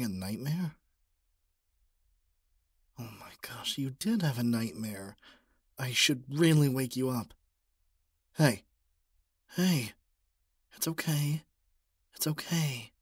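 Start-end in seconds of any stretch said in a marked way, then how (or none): 3.0-3.8 startled whisper. Acted. Whispering over sleeping listener. 8.4-12.9 urgent, higher wake-up calls. Acted. Waking someone from nightmare.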